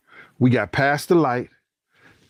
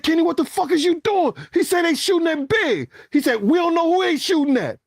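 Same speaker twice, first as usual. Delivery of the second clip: very high, strained voice; frantic back-and-forth in the car; retold panic.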